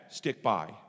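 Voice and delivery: low, soft, steady answer; calm divine voice, low and unhurried; God's calm reply. Retold or imitated. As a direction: answer quietly and firmly, pitch dropped. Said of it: "And God says".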